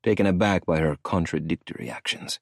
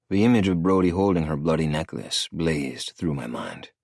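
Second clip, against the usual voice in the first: plain reading voice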